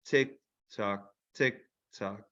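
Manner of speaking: soft low tick tock; ticking clock. Acted. Narrator mimicking a clock for listeners, soft ticking. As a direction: tick away the slow hours.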